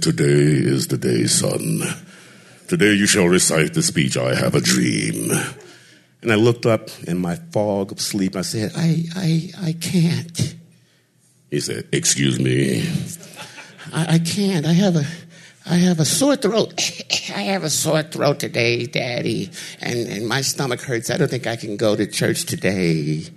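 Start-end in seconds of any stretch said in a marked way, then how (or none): 0.0-5.3 stern father. Retold or imitated. Father orders son. 8.7-23.3 put-on very high small child's voice, making excuses; whiny little boy. Son pleads sick to his father in a high child's voice.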